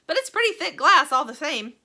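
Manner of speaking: loud, bright and breezy; cheerful. A narrator's reading. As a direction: brush it off cheerily. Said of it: "cheerful as ever".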